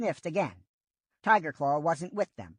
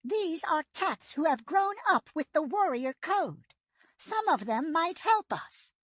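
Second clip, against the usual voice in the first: put-on higher, urgent old she-cat voice; Yellowfang's voice; 13 semitones higher than usual. A narrator's reading. Old she-cat offering help from Shadow Clan, higher voice. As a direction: voice the old medicine cat, hopeful.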